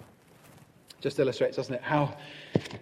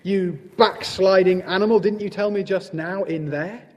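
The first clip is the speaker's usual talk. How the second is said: loud, high-pitched scolding; a scandalised badger demanding an answer; indignant cry; 6 semitones higher, 8 dB louder than usual. A narrator's reading. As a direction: launch in forcefully, accusing. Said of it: "cried Badger".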